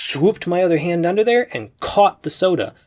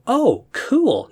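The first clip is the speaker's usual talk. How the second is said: impressed; 8 semitones higher than usual. Retold or imitated. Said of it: "Do you instantly go".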